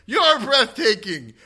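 high, drawn-out fan shout; imitated audience yell. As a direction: holler it slowly, like a fan from the crowd. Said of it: "someone in the audience yells out".

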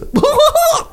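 high-pitched mock throat-clearing cackle; exaggerated falsetto laugh mimicking a friend; Waleed impression. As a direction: burst of silly high cackling. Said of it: "Waleed clears his throat like this".

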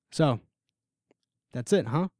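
even, neither angry nor kind; flat question, no anger, no pity; neutral. Acted. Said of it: "I don't say it with anger or sympathy".

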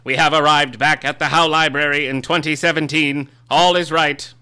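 high, loud, pompous gentleman voice; grand self-important literary heir; Christopher Tolkien voice. Acted. Holds the Tolkien character voice.

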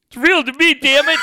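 high, loud hammed-up protest; playfully overwrought fan outburst; mock impassioned shout. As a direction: cry out like an outraged true believer. Mock outburst of believing wrestling fan.

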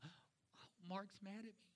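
a gasped whisper, then high and anxious; mock insecure God. Preacher mocks the idea of a worried God, high panicked voice. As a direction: lampoon a nervous, insecure God with a squeaky panic. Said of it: "God's not insecure".

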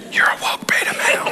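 whispered through a chuckle; laughing whisper. Real, not acted. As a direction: tease them under your breath, laughing. Teasing jab at offended listeners.

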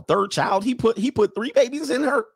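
high-pitched, disbelieving question; incredulous. Real, not acted. Host reacting to news of a third child, incredulous. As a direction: pitch climbing in real disbelief.

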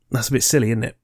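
ordinary talk, nothing marked

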